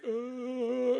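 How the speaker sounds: a high put-on groan of dismay; mock groan. Pastor imitating the crowd's high groan at the photos. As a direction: groan it like the whole crowd did.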